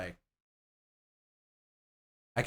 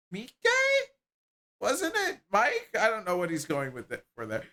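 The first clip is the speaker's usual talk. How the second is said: higher, animated character voices; two game characters bickering, voiced higher; voiced game dialogue; 10 semitones higher, 4 dB louder than usual.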